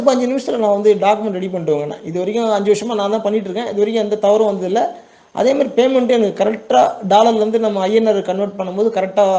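normal talking voice

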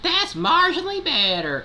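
very high squeaky cartoon voice; squeaky Toad voice. Still voicing Toad.

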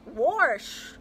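mock the manager's Kansas City warsh; imitating a Kansas City warsh. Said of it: "She goes".

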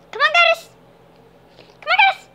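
high, loud coaxing call to the cat; sing-song call to bring the cat over; squeaky "come on" to a pet. Real, not acted. Calling cat to fetch.

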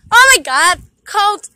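loud startled cry from cold; cold shock outcry. Real, not acted. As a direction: blurt it out from icy shock. Standing in icy pond.

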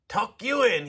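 bellow. A narrator's reading. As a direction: bellow it in disbelief. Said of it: "he bellowed".

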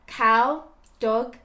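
plain reading voice

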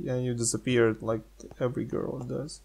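usual speaking voice

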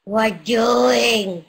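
playful loud calling. Acted. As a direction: call out to Blanquita across the room. Calling out to Blanquita.

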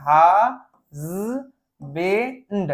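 husband in pieces, "haa... z... bend"; slow, segmented teaching demo; broken into sounds. Shown as a demonstration. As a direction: sound out husband with a pause after each part. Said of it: "একদম ভেঙে নেবে".

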